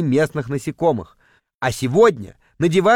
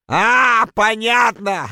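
high, drawn-out "aha" breaking into laughter; laughing realisation; 5 semitones higher, much slower than usual. A narrator's reading. Guide hearing what the diver did, laughing realisation. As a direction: burst out laughing as you catch on.

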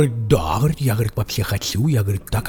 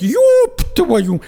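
startled shout; 5 dB louder than usual. Retold or imitated. Window opens onto abyss.

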